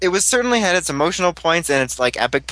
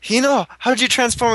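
much higher, wide-eyed mock question; mock surprised friends; 9 semitones higher than usual. Fan voicing friends asking the hero about transforming. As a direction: ask it in a hammed-up amazed voice. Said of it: "they're like".

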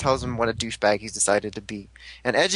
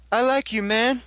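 bro voice; 9 semitones higher than usual. Retold or imitated. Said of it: "And Date's like".

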